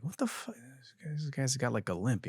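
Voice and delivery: hushed, under the breath disbelief; muttered shock. Retold or imitated. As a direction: say it to yourself so he can't hear. Seeing limping stranger approach.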